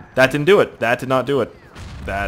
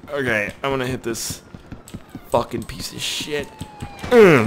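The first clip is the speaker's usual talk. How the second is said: ordinary delivery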